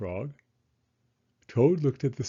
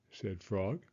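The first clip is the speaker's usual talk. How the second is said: plain reading voice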